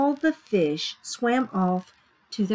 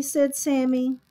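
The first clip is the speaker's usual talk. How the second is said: ordinary delivery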